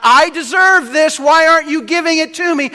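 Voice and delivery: high, loud indignant whine; mock the entitled complaint; entitled demand. Preacher mocking the world's entitled demand of God.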